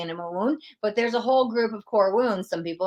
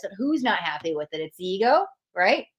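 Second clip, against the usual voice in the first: ordinary talk, nothing marked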